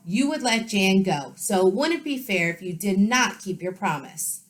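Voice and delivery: lively read-aloud for children with voiced characters; story narration. A narrator's reading. Teacher reading a storybook to her students, lively. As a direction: read it brightly to the class.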